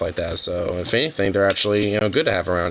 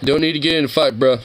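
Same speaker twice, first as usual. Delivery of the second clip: higher, pleading bro voice; calm a mate down, pitched up; critter breaking up a fight; 6 semitones higher than usual. Retold or imitated. Critter begging a friend not to fight, higher bro voice. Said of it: "It's like".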